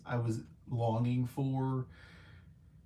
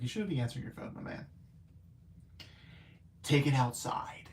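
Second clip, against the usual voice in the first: hushed scolding; faster than usual. Retold or imitated. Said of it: "I was just like".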